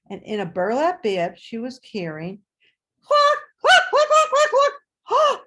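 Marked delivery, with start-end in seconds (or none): 3.0-5.4 high, loud clucking chicken imitation. Chicken squawking in burlap bag.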